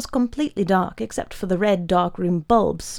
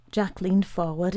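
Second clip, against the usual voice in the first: ordinary delivery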